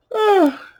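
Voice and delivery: loud high open-mouthed yawn; a real sleepy yawn out loud. Real, not acted. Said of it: "I'm so sorry I'm yawning".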